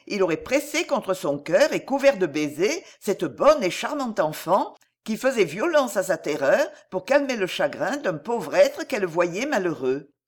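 usual speaking voice

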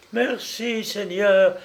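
high, grateful exclamation; relieved cry. A narrator's reading. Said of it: "s'écria Marie en se relevant".

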